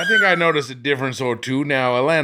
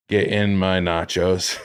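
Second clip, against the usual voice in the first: laughing slogan; 7 semitones lower, slower than usual. Pretend. Joke line for burrito shop.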